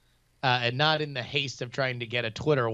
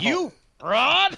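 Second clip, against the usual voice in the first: higher, louder accusing cry; mock outrage; 11 semitones higher, 5 dB louder than usual. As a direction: call him out with mock outrage. Playful accusation repeated louder.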